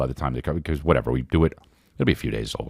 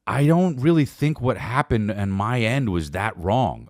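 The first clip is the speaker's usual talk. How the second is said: higher, slow, hesitant put-on voice; mock reluctant non-apology; 6 semitones higher, much slower than usual. To his wife, a higher, slow, halting mock non-apology. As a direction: stammer out a grudging refusal to apologise. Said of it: "I was like".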